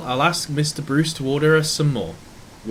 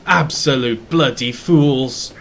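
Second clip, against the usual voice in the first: angry curse; 7 dB louder, slower than usual. Acted. Railway owner watching engine scream past.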